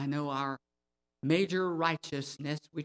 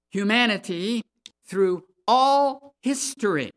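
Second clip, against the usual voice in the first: loud, slow preaching, each phrase hammered out; forceful preaching; 5 semitones higher, 8 dB louder, slower than usual. Real, not acted. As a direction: preach it hard, stretching the key words.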